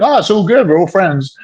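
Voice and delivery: jumps high, breezy and smooth-talking; mock breezy reassurance. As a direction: play it off like nothing's wrong. Reassuring two suspicious dates.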